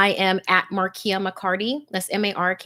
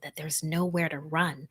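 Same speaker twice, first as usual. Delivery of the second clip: slow, creepy whisper; host savoring the horror, slowly whispered; ominous whisper; 3 semitones lower, 6 dB quieter than usual. Acted. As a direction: let the threat hang in a whisper. Dramatic retelling of story's dread.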